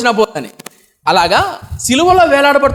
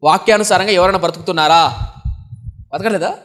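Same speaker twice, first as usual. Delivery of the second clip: usual speaking voice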